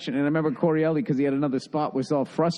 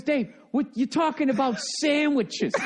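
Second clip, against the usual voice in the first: higher, laughing mock scorn; imitating Sebastian; 9 semitones higher than usual. Sebastian teasing Kimowitz about brisket, higher laughing scorn. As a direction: scoff through a laugh. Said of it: "he goes".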